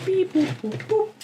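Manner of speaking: squeaky high beep-boops; high robot beeping. Retold or imitated. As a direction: chirp like a toy robot. Copying the toy robot beeps.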